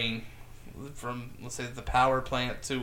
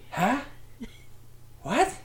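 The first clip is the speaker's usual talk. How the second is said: much higher, puzzled tough-guy voice; 10 semitones higher than usual. Acted.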